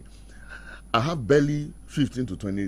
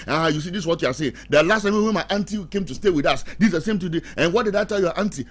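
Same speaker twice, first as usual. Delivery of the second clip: heated quarrel; 6 semitones higher, 6 dB louder, faster than usual. Retold or imitated. Quarrel continues, acted.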